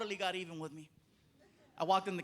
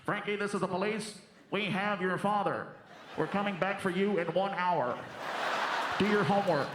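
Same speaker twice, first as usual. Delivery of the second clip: mock police announcement. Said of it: "and I said".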